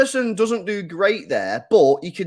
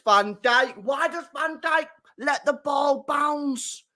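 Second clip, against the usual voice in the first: ordinary talk, nothing marked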